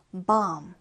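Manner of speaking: "bomb" ending on the m, b left silent; silent final b. Shown as a demonstration. Teacher shows learners how to pronounce it, "bomb" with silent b. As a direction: say "bomb" with no b sound at the end. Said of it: "B 발음은 나지 않고 묵음 처리입니다".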